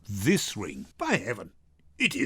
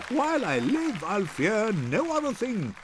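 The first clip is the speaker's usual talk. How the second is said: laughing; 8 semitones higher than usual. Acted. Graziano's bawdy closing jest.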